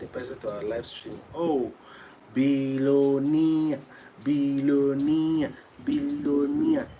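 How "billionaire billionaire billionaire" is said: drawn-out call. Real, not acted. Calling out viewer name.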